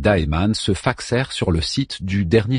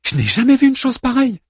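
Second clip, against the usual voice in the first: high, fast, exasperated; 3 dB louder, faster than usual. Acted. Anna straining at forcefield.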